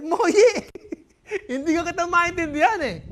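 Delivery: high, loud laughing cry; cackling dad; laughing disbelief. Acted. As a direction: burst out laughing at it.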